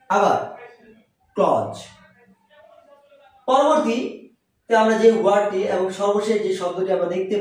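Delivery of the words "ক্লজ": ক্লজ in one syllable; ক্লজ with buzzed ending, no th; correct pronunciation. Shown as a demonstration. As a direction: say ক্লজ as "kloz", low. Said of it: "এর আসল উচ্চারণ".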